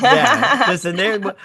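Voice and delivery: loud laughing yeah. Real, not acted. Cracking up mid-podcast, loud laughing.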